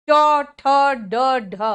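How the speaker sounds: "ट, ठ, ड, ढ" punched out one by one; forceful separate letter sounds "ट, ठ, ड, ढ"; strong consonants. Shown as a demonstration. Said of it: "उतना स्ट्रॉन्ग हिंदी में नहीं होता". ("ट, ठ, ड, ढ" ज़ोर देकर, अलग-अलग)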